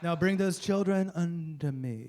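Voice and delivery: drawn-out, odd crooning delivery of scripture; weird preacher voice. Acted. Comic voicing Jesus to explain the crucifixion, weird voice. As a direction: put on a strange, slow holy-man voice. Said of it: "he must have had a weird voice".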